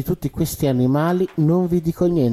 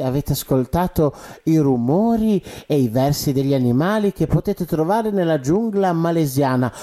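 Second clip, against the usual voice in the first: normal talking voice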